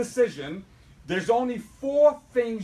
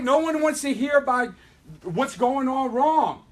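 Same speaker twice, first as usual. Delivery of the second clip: usual speaking voice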